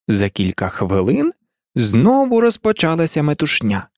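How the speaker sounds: ordinary delivery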